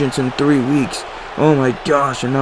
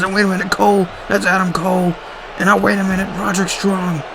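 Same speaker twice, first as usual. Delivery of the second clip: surprised announcer; 6 semitones higher, faster than usual. Acted. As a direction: pitch jumps higher in sudden commentator shock. Surprise run-in during commentary.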